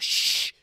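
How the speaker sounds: loud hissed shush; forceful shush. Retold or imitated. Mother leaning over to older girl, sharp shush. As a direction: shush a child who just yelled.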